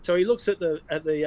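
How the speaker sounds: normal talking voice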